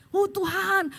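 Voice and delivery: half-played lament; mock crying. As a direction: imitate a tearful plea to God. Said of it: "Udahannya saya nangis".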